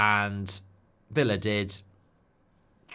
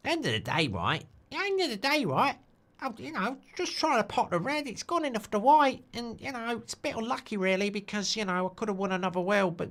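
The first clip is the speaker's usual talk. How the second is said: London snooker player, offhand; 9 semitones higher than usual. Acted. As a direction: shrug off the win in a high London voice.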